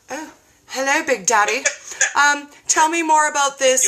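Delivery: mock-seductive purr at the bacon, hammed-up; playfully flirting with a diet video; flirty swoon. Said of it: "and I was like".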